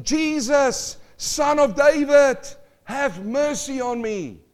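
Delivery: loud, high, slow cry for mercy; blind man crying out. Acted. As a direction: call out across the crowd, slow and high. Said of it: "And he cried out".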